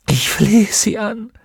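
high-pitched anguished plea; tormented entreaty; desperate begging. Acted. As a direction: beg for mercy, voice pushed high. Tortured witch begs.

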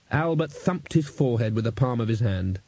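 ordinary delivery

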